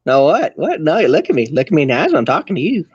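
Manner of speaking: insistent, swooping Southern twang; heavy Southern accent. Shown as a demonstration. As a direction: rattle it off in a hammed-up Southern accent. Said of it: "heavy Southern accent".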